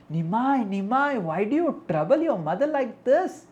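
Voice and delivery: high, loud chiding questions; scolding ladies. Acted. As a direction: take a naughty boy to task.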